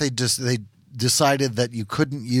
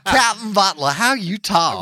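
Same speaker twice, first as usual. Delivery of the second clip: Southern belle; 6 semitones higher, 8 dB louder than usual. Acted. Gone with the Wind banter.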